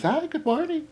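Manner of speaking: high, sing-song lady's hello; lady's greeting. Retold or imitated. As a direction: chirp it like a friendly lady at the door. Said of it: "a lady comes out and says".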